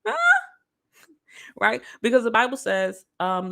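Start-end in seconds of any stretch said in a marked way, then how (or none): 0.1-0.2 high, loud cheer for her friend; loud excited encouragement. Retold or imitated. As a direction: egg her on: girl, cry. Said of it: "I was like".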